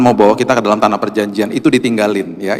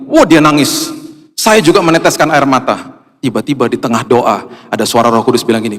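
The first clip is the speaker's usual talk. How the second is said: normal talking voice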